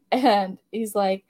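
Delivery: ordinary delivery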